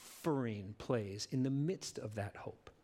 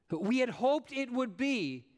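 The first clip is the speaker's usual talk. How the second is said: disappointed lament; 8 semitones higher, 4 dB louder than usual. Acted. Voicing disappointed believers.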